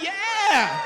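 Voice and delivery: loud, high whoop; excited shout. Pretend. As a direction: cheer it out. Excited about young Obama.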